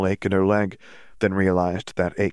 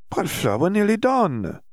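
high, slow, dreamy protest; woozy protest; 12 semitones higher than usual. Acted. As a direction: drift through it like she's floating. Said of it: "she said".

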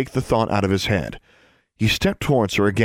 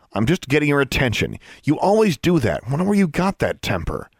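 higher-pitched, scolding comeback; heated retort; 7 semitones higher than usual. A narrator's reading. Sister chiding her brother for his temper, heated retort. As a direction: throw it back at a raised pitch.

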